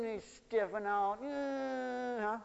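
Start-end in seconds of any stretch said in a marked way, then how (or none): 1.2-2.2 high, drawn-out whiny hum; whiny child's fuss. Retold or imitated. Parent mimics child resisting the snowsuit, high whine. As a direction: imitate a cranky kid straining, high and long.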